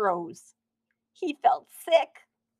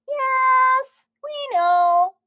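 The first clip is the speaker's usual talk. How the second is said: high, loud shouted reply; a donut calling back to the baker; 9 dB louder, much slower than usual. A narrator's reading. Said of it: "the donut shouted back".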